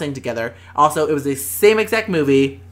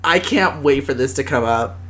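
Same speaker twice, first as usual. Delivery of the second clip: ordinary talk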